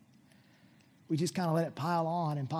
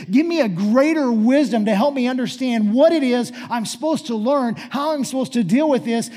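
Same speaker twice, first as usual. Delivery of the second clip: louder, raised, pressing plea; urgent preached prayer; 6 semitones higher, 13 dB louder than usual. Acted. Pastor voicing a prayer for wisdom, urgent preaching. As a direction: plead forcefully as a preacher.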